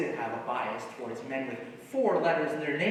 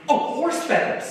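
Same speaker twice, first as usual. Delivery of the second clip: excited recognition; 12 semitones higher, 6 dB louder than usual. Real, not acted. Suddenly remembers the title.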